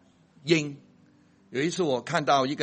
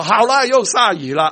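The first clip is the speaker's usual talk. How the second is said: bright, lifted, louder aside about the rain; cheerful remark; 6 semitones higher, 9 dB louder than usual. Retold or imitated. Preacher voicing himself welcoming the rain, cheerfully. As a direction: greet the rain gladly, a notch up.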